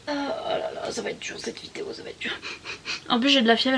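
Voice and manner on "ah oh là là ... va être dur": hammed-up fake crying; fake sobbing. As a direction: fake a melodramatic sob. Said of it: "sortez les mouchoirs".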